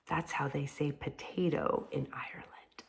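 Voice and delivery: soft secret aside to the class; an unvoiced whisper explaining a word. Real, not acted. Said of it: "teacher's whispered aside".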